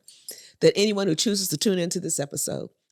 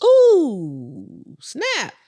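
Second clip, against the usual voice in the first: impressed ooh; 6 semitones higher, 6 dB louder than usual. Real, not acted. Reacting to a sharp line.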